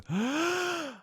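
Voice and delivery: high, fast audible in-breath; big gasp in. Shown as a demonstration. Teacher shows how all laughter starts, big gasp. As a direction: gasp sharply before a laugh. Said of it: "You go".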